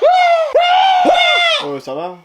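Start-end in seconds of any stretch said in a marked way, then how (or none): 0.0-0.6 loud high shriek of horror; horrified shriek. Acted. Parent shrieking at an eighteen and a half. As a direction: scream as if the grade were a catastrophe.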